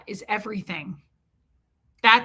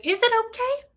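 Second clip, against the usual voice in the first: incredulous question; 9 semitones higher, faster than usual. Retold or imitated. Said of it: "I was like".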